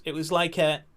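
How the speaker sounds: usual speaking voice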